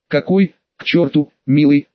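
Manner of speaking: usual speaking voice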